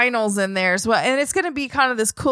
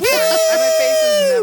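exaggerated glee; 6 dB louder, much slower than usual. Real, not acted. Gleeful boast.

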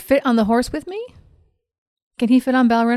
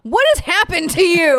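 angry; 8 semitones higher than usual. Acted. Tira confronts Peter angrily.

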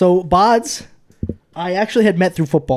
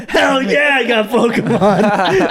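excited; 6 semitones higher, much slower than usual. Retold or imitated. Excited kid reply retold.

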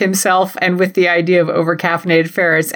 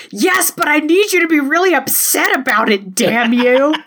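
mock demanding Breeze; 8 semitones higher than usual.

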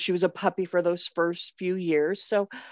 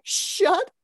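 high, drawn-out frustrated "shut up"; exasperated retold yell; frustrated snap; 11 semitones higher, 6 dB louder than usual. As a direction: lose it for a second at the dog. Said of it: "I kind of lost it".

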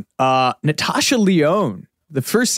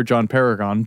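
normal talking voice

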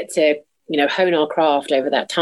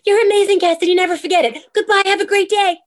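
high, loud tiny-fairy voice; squeaky loud little sprite cheering her on; magical little people; 3 dB louder than usual. Pretend. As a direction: chirp it like a pop-up pixie. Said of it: "magical little people that just pop up and go".

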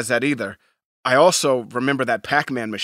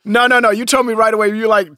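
ordinary talk, nothing marked

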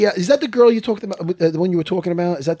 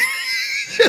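laughing shriek; 4 dB quieter than usual. Retold or imitated. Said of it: "he's doing the slug impression".

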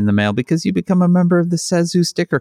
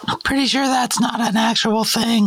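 put-on high, polite teen cashier; chirpy drive-thru kid behind the counter; fast food cashier voice; 3 semitones higher than usual. McDonald's cashier roleplay.

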